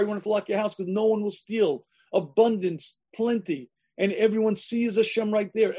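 ordinary talk

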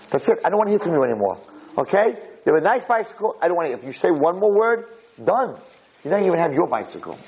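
high-pitched imitation of a fed-up father; exasperated scolding. Father warns his nagging son about the bicycle, exasperated scolding.

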